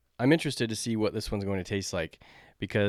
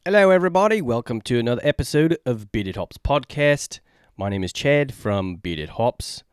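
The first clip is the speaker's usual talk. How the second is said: put-on a failed English accent that drifts toward Australian, pitched up and louder; bad English accent; 3 semitones higher, 9 dB louder than usual. Chad greeting podcast listeners in a botched English accent. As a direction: try a posh English accent and miss it. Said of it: "I can't do an English accent".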